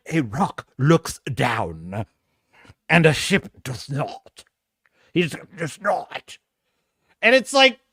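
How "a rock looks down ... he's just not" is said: very slow, low, portentous posh English; posh English accent. Retold or imitated. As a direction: draw it out gravely in mock-posh English. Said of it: "They take a posh English accent".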